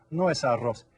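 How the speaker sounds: ordinary talk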